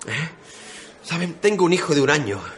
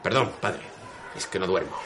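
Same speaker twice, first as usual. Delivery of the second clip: much lower and softer, worn out; tired apology; 9 semitones lower, 10 dB quieter than usual. Acted. Sergeant apologises to the priest, worn out. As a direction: mumble it, exhausted.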